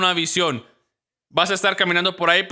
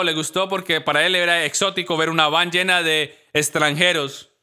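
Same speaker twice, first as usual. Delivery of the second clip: ordinary delivery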